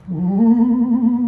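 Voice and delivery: high, drawn-out ooh; a wondering high ooh; surprised ooh. Acted. Character reacts, high and far.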